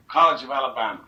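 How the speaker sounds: loud bare place name as a question; blunt question. Retold or imitated. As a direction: call out just the name, no pleasantries. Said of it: "by just saying the College of Alabama".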